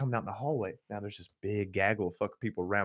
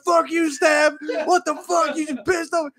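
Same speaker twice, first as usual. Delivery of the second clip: high, loud mock-furious yell; imitated angry crowd shouting; fighting mad; 12 dB louder than usual. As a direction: yell like an outraged victim. People getting fighting mad.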